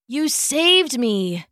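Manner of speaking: slow, hoarse croaked thanks; croaking. Acted. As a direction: croak it out, voice worn. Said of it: "he croaked".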